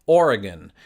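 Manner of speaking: "Oregon" said OR-i-gun, first syllable stressed; Oregon with an unstressed ending; British Oregon. Shown as a demonstration. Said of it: "closest to mine".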